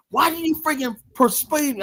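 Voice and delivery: high, whiny mock complaint; imitating her whining at him; indignant complaint. Friend blaming him after court, in a high whiny mock voice.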